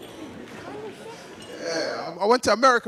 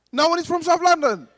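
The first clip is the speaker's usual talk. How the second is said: higher, louder disbelieving question; mock disbelief; 8 semitones higher, 5 dB louder than usual. Real, not acted. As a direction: act shocked nobody's there. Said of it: "Anyone from South London?".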